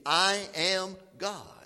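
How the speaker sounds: slow, drawn-out I am God with weight on each word; emphatic I am God. Shown as a demonstration. Preacher leaning on I am God. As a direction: press I am God home to the congregation. Preacher stresses the divine name.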